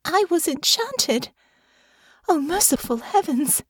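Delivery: horrified realisation; horror. A narrator's reading. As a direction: let the horror sink in. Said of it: "she thought with horror".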